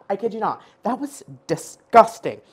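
usual speaking voice

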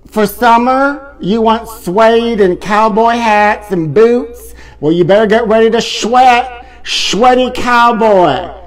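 lightly voiced cowboy host; playful country host. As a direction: ham up the country showman. Country host persona, [name].

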